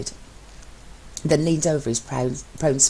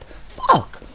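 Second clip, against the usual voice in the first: a sharp cry of shock at the spill; high startled outburst; startled curse. Acted. Hot tea spilled on his lap.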